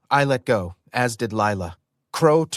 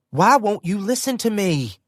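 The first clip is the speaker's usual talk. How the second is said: higher, pleading shout; frustrated yell; 9 semitones higher than usual. Acted. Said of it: "Lila yelled".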